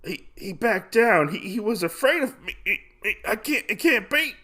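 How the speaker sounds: high, halting mock dying voice; dying villain. Dying duelist who cannot believe he lost, high faltering voice. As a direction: stammer it out in acted disbelief. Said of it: "Kidemono ends up dying".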